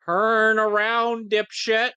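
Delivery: loud, high, slow yell; yelling at screen. Real, not acted. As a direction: bark it at the screen.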